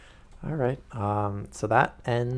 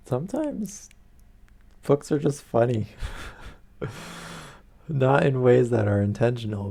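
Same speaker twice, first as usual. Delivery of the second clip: amused aside; 4 semitones higher, 4 dB louder than usual. Real, not acted. Laughing aside to chat.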